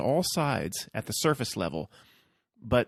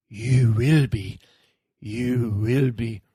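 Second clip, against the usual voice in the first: Yoda; 6 dB louder, much slower than usual. Retold or imitated. Yoda warns Luke he will be afraid, slow ominous creature voice. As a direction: draw it out like a grave little sage. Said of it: "to Yoda".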